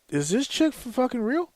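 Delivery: can't believe what he's hearing; high, incredulous aside; incredulous disbelief. Retold or imitated. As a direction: pitch jumps up in disbelief. Retelling absurd customer service call.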